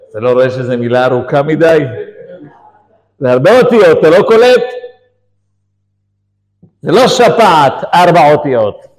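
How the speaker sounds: loud, emphatic telling-off; exasperated pharmacist. Retold or imitated. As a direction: spell out the obvious at high volume. הרוקח מסביר בחוסר סבלנות. (רוקח מתרגז בקול רם)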